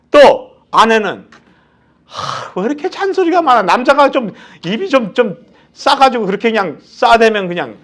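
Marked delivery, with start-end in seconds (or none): 2.0-6.8 huff, then fire off the nagging complaint; exasperated wife. Retold or imitated. Wife fed up with her husband's nagging, sighing and complaining. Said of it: "이러는 거예요".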